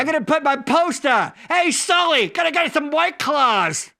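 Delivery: mock Boston yell, big and punchy; Boston accent. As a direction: holler like a Boston guy calling his buddy. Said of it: "It's the accent".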